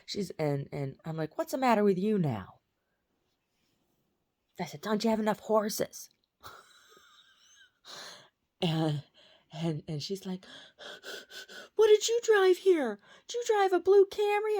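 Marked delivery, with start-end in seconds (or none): 1.4-2.6 loud, raised-pitch retold annoyance; exasperated demand. Daughter confronts her crying sister in a dream, loud exasperated demand. 4.8-6.1 retold exasperation. Taunting sister in retold quarrel. 11.8-14.6 mock hysterical screaming. Sister comes in screaming and crying, hammed-up hysterical shriek. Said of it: "my sister comes in screaming and crying".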